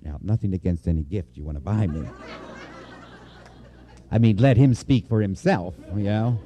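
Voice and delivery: ordinary talk, nothing marked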